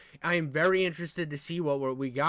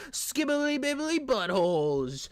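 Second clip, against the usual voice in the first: high, slow goofy cartoon voice; silly cartoon voice; 6 semitones higher, slower than usual. Free to swear, goofy high cartoon voice. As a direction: blurt nonsense like a cartoon. Silly voice after saying he can't be demonetized.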